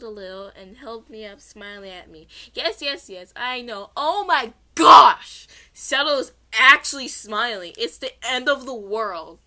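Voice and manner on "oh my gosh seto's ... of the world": overreacting. Real, not acted. Narrator sees stern Seto smiling and overreacts loudly. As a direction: shriek as if the world is ending. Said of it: "I overreacted for this one".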